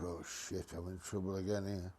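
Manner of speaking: low, soft muttered dread; dreading trouble. Retold or imitated. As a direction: mutter it under your breath, caught out. Dread on seeing the boss.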